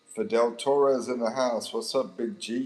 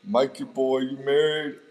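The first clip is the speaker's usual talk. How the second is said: loud, higher playful holler; calling out; 5 semitones higher, 8 dB louder, slower than usual. Pretend. Streamer teasing a viewer about marriage, loud playful holler. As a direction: holler it at a buddy, drawing out the end.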